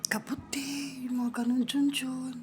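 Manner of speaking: whispered answer, barely voiced. Real, not acted. Child whispering the quiz answer to a friend, hushed. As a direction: whisper it so the others miss it.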